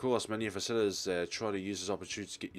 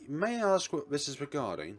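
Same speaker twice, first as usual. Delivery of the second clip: higher-pitched polite woman's voice; young lady; 6 semitones higher, 3 dB louder than usual. Acted. Young lady on the intercom questioning visitors, polite. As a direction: answer politely in a lifted voice. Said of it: "a young lady answers it".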